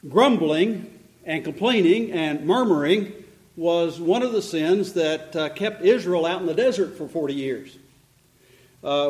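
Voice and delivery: ordinary delivery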